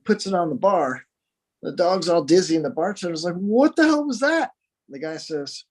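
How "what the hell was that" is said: high, incredulous outburst; startled bartender. Acted. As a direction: act out a bartender's startled disbelief. Bartender reacts to dog being swung.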